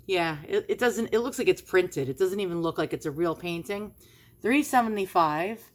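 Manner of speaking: ordinary talk, nothing marked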